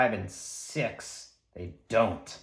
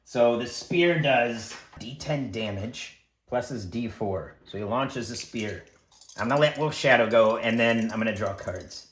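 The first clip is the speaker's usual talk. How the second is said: usual speaking voice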